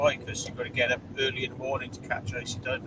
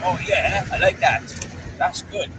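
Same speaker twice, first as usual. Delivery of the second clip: higher, louder mock-delighted dog voice; voicing the pleased dog; 4 semitones higher, 4 dB louder than usual. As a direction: play the dog relishing the cool air. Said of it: "She's like".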